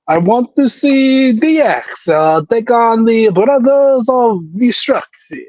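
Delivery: put-on Saudi Arabian accent that drifts Italian; mock Saudi Arabian accent. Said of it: "with that Saudi Arabian accent".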